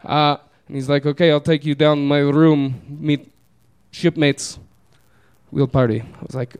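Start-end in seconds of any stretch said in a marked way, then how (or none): 1.1-6.0 imitated Eastern European, in broken phrases; Eastern European accent. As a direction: invite them along in an Eastern European accent. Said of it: "almost seven foot tall Eastern European man".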